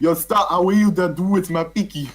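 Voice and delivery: put-on high, loud Jamaican accent. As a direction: read it in a big Jamaican accent. Reading Jamaican copypasta.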